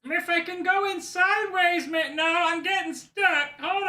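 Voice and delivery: put-on high, goofy, childlike bird voice; Big Bird voice. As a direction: squeeze through the door as the giant bird. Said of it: "It's Sesame Street's Big Bird".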